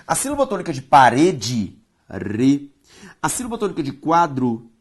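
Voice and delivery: ordinary delivery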